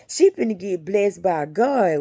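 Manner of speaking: normal talking voice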